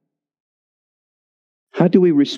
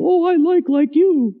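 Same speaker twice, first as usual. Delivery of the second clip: high falsetto reply; mock a sweetheart in falsetto; put-on high girlish voice; girlfriend's voice. Acting the woman's replies, falsetto.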